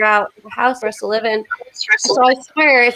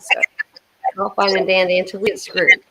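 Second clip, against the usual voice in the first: ordinary talk, nothing marked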